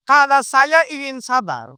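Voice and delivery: slowed and weighted, each word set apart; drawn-out teaching emphasis; slow emphatic. Shown as a demonstration. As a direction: lean on "Kalau saya ingin sabar" slowly and firmly. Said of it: "fokus saya ke dia yang marah-marah".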